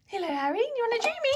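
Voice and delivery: high sweet voice to the cat. Real, not acted. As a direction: coo at your pet. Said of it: "talks to their cat in a cat voice".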